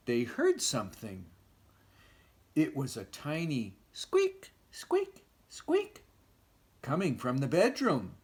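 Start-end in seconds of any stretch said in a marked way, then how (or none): 3.9-6.0 very high squeaky sound; mouse squeak. Acted. Imitated squeak.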